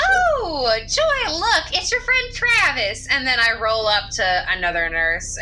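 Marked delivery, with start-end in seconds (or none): 0.1-3.1 high, bright-voiced cheery greeting; bubbly greeting. Player character greeting Joy, high cheerful call. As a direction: sing it out like a chipper guide. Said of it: "and I'm like".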